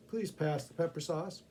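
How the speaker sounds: ordinary delivery, nothing marked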